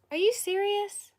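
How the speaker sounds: high outraged Are you serious; disbelief. Retold or imitated. Reacting to a spoiler comment, high shocked disbelief. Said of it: "I was like".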